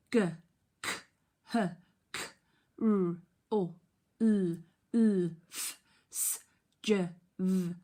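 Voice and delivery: put-on loud, clipped letter sounds for children to copy; phonics sound drill. Shown as a demonstration. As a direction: punch each sound out for the class. Teacher leads sound drill.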